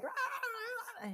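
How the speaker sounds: high mock toddler growl; child tantrum roar. Toddler whose Lego tower fell, mock roaring. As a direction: play an upset toddler raging at fallen Legos. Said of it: "they're like".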